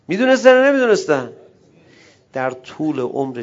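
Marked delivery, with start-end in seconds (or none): 0.1-1.3 loud, high challenge to the crowd; rhetorical shout. Real, not acted. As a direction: fling the question at them. (فریاد پرسشی)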